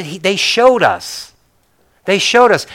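usual speaking voice

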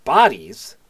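shocked, high and loud "Bodies?"; startled question, pitch jumping up; taken aback. Acted. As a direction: Mel caught off guard, blurting out the word. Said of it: "is taken aback from something that was said, and he goes".

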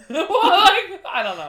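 laughing too hard to talk; words lost in laughter. Real, not acted. Laughing at own mishearing.

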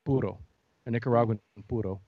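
puro said as poo-toh, tapped r; corrected Spanish. Shown as a demonstration.

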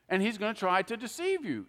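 ordinary delivery

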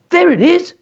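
put-on a boy calling to mislead his father; yelling. A narrator's reading. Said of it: "yelled David".

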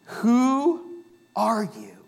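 drawn out slow question; slow rhetorical question. Shown as a demonstration. Pastor posing the question to the congregation, slow. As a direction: let the question hang, slowly. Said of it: "not a question somebody asks you".

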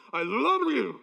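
numb face. Real, not acted. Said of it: "My face is numb".

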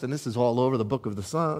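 ordinary delivery

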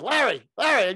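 high, loud acted call. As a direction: call urgently to wake him. Calling up dead mentor.

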